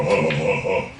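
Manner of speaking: low death-metal singer impression. Death metal singer taunting the drunk comic, low mock voice. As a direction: drop low for a gruff metal frontman's taunt. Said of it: "Corpse tried to grab me afterwards and go".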